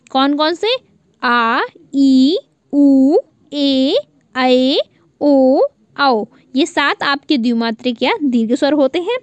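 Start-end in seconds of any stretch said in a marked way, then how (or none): 1.2-6.2 आ ई उ ए ऐ ओ औ drawn out, louder; long vowels. Shown as a demonstration. Teacher answers her own question, stretching each vowel. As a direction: sing out each vowel long, two beats. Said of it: "दीर्घ या द्विमात्रिक स्वर".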